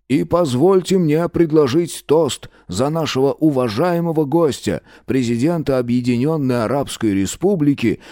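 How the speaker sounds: lightly voiced old party leader; a hint of a solemn state toast; Soviet politician toast. A narrator's reading. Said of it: "странным, бурчащим, причмокивающим".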